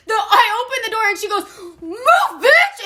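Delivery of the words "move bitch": high, loud mock yell; overexcited yell. Excited friend shoving the teller out of the car, amped-up yell. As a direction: blurt it like a hyped-up friend. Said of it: "And she gets so excited".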